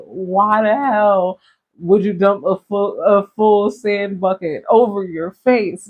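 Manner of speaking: loud, drawn-out incredulous scolding; mock outrage at a foolish kid; exasperated disbelief. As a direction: demand an answer, slow and emphatic. Incredulous reaction to crying child.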